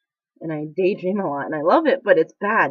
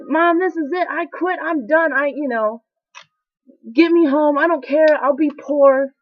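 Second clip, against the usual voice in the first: high, loud, furious outburst at mom; retold angry rant; 8 semitones higher, 5 dB louder than usual. On the phone, yelling at mom that you quit, furious. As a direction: yell that you quit and are coming home. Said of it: "so mad, cussing her".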